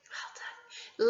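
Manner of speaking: soft whispered praise; whispered praise to the children. Acted. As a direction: whisper well done.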